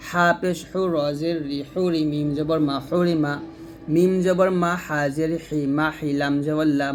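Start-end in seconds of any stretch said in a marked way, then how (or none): none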